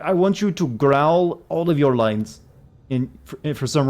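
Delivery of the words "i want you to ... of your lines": slow, growly mock Russian accent; mock Russian director. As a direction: play Kojima as a Russian director, hammed up and slow. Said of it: "Kojima is Russian".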